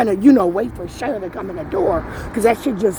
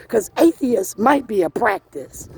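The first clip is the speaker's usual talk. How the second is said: ordinary talk, nothing marked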